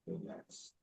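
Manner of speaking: Relax breathed out as a bare whisper; whispered relax. Shown as a demonstration. Teacher letting relax fade to a whisper for the resting class. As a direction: let relax dissolve into a whisper.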